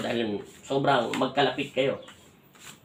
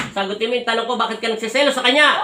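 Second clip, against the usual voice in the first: high, loud interrogation; stern demand; 8 semitones higher, 4 dB louder than usual. Real, not acted. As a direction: order them sharply to answer. Healer demands an answer.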